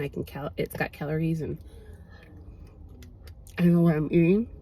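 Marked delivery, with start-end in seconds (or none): 3.6-4.5 talking while chewing; words around a bite of food; mouth full. Real, not acted. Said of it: "I should not talk with my mouth full".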